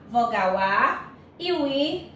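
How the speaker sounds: ordinary delivery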